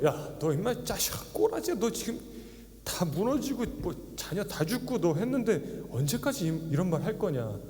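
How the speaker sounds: high-pitched mocking scold, hammed up for effect; exaggerated emotion. Pastor voicing Bildad sneering at Job, exaggerated angry scold. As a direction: play Bildad taunting a ruined man, going way over the top. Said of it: "이건 제가 좀 과장한 거예요. 감정을 넣었어요".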